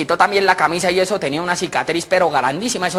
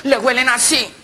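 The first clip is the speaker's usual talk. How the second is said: put-on high, excited stammering kid; breathless child; 7 semitones higher than usual. Boy rushes to tell mom about the dog, high and excited. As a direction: trip over words like a worked-up child.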